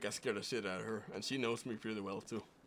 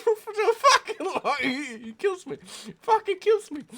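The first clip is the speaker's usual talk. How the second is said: high, loud words through real laughter; laughing; 14 dB louder than usual. Real, not acted. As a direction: crack up at your own failed accent. Cracking up at own accent.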